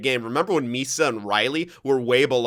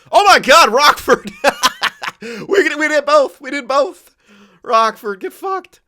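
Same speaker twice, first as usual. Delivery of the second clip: much higher, loud laughing gloat; laughing triumph; 11 dB louder than usual. Real, not acted. Gamer laughs triumphantly at rival Rockford, loud and high.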